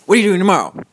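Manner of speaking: host grills his sister, mock insistent; insistent demand. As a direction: press her for the answer again. Host repeats question, demanding answer.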